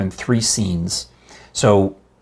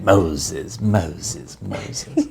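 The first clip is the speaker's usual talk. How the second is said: lower, fading to a whisper; Ten Commandments voice; 3 semitones lower, much slower than usual. Retold or imitated. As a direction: call the name and let it die away. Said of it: "Ten Commandments, it's in my head".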